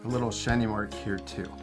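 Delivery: ordinary talk, nothing marked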